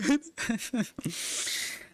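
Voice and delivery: high breathy wheezing cackle; ugly laugh. Real, not acted. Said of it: "such an ugly ass laugh".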